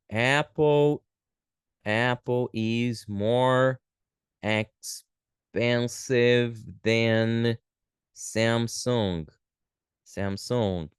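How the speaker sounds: very slow, stretched words with pauses; slow dictation. Shown as a demonstration. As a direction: dictate it word by word. Teacher writing out example sentence.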